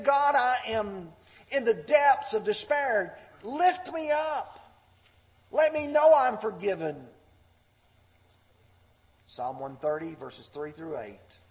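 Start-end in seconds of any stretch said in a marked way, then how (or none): 0.0-7.1 high, loud acted cry to God; desperate plea, imitated. Preacher acting David in the depths of despair, desperate plea. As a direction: cry out for rescue as David, voice raised.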